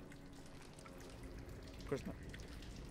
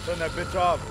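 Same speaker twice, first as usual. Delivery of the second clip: louder, higher urgent order; raised voice; 5 semitones higher, 7 dB louder than usual. Real, not acted. Player urging the TV be switched off, voice raised. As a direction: raise your voice to insist.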